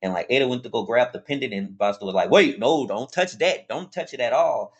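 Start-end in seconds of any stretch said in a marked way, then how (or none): 2.3-4.7 sharp, raised alarm, louder and higher; a quick, loud don't-touch-that; urgent warning. Retold or imitated. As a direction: snap out a warning to stop her. Buster urgently warns Ada off.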